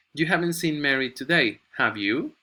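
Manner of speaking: "have you" sweeps up at the end; rising tag. Shown as a demonstration. Teacher showing students a real question, rising "have you". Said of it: "Go up".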